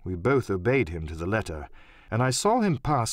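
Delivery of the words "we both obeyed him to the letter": usual speaking voice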